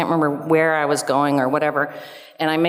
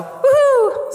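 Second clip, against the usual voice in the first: very high, slow, loud cheer; woohoo; 5 dB louder than usual. Real, not acted. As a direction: whoop it out in triumph. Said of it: "celebrating escaping ticket".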